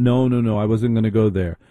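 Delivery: ordinary delivery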